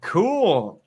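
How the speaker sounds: ordinary talk, nothing marked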